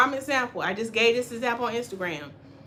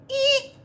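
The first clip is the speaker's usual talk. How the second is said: squeaky eek. Retold or imitated. Voicing her shrunken wash and go.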